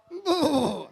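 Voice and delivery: high goat voice; bleating goat. Shown as a demonstration. Goat voice imitated.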